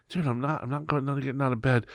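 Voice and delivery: soft, groggy-sounding protest; sleepy refusal. Retold or imitated. Teenager in bed answering his boss, soft sleepy protest. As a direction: refuse quietly, as if from under the covers.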